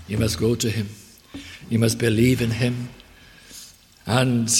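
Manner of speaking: normal talking voice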